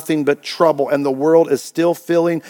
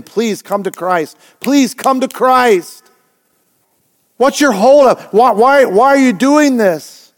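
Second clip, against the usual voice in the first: retold plea, higher and louder as it goes; pleading father-in-law; 6 semitones higher, 4 dB louder than usual. Father-in-law begging his brother, rising plea. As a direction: beg a dying brother to come to Christ. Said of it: "my father-in-law was pleading with him".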